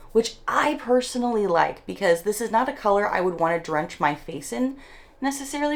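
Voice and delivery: ordinary talk, nothing marked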